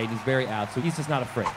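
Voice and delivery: normal talking voice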